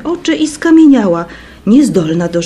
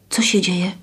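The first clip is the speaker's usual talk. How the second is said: anxious; 5 dB quieter than usual. A narrator's reading. Girl asks anxiously.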